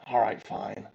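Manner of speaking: sighed, grudging agreement; quiet, reluctant surrender; resigned. Acted. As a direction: give in with a heavy breath, low and soft. Shield gives in after refusal.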